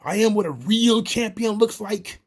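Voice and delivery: mock-intense wrestling promo; intense wrestler impression. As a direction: play an over-intense champion's boast. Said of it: "is hilariously intense".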